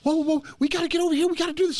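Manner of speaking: put-on high, rapid-fire kid voice; excited little kid. Gamer imitating a little kid in Call of Duty, high and frantic. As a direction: mimic an over-eager kid in a high rush. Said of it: "I blew this little kid up".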